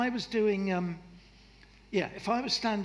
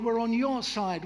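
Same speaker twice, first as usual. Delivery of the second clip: ordinary talk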